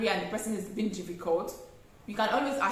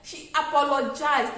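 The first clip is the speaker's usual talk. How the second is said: normal talking voice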